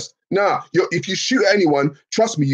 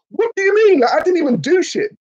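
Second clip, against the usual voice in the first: high, louder disbelieving protest; indignant outrage; 3 dB louder than usual. Acted. Told he will burn despite doing nothing, indignant squeal. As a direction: protest like you're wrongly accused.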